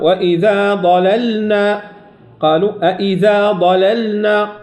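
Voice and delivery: ضللنا slow, the ل drawn out; held لام. Shown as a demonstration. Teacher modelling the lingering ل of ضللنا. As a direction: stretch the ل in ضللنا. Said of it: "ضللنا كالأولى، اللام هنا".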